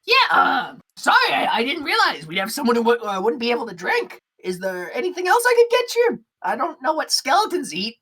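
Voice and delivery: high kobold barkeep voice; apologetic bartender. Acted. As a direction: stumble through an apology, pitched high. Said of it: "And, uh, he goes".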